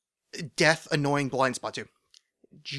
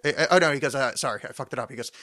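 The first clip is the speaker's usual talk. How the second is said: ordinary talk, nothing marked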